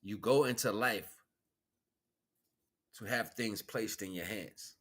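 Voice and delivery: ordinary talk, nothing marked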